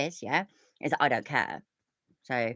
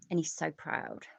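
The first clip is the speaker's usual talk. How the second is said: ordinary talk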